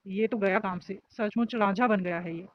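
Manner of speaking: ordinary delivery, nothing marked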